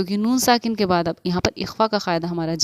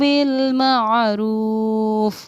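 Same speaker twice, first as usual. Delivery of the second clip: slow, melodic tajweed recitation, louder; Quran recitation; 2 semitones higher, 4 dB louder, much slower than usual. Acted. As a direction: recite the ayah in long breaths with ghunna. Recites the full ayah. (धीमी, सुरीली तिलावत)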